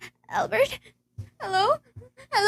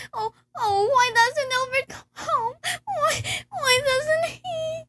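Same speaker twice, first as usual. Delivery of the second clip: rising, slow, breaking into sobs; crying out for her absent husband; tearful despair; 6 semitones higher, slower than usual. Acted. Invalid wife alone at night, sobbing for Albert.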